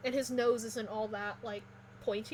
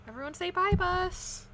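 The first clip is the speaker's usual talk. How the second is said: preschool teacher; 7 semitones higher, 4 dB louder than usual. Acted. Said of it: "I sound like a preschool teacher".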